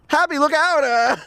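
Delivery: high wooden warning cry; bad acting. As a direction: ham up a stiff "look out". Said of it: "It's outrageous".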